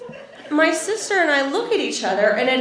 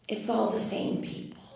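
very soft, nearly whispered mom voice; hushed disclosure; 4 semitones lower, 14 dB quieter than usual. Retold or imitated. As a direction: imitate mom letting out a secret under her breath. Mom guardedly reveals family secret.

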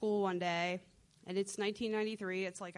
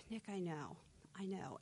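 low, hushed mock mom; quiet, knowing mom voice; soft knowing reply; 3 semitones lower, 13 dB quieter than usual. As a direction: murmur it like she already knew.

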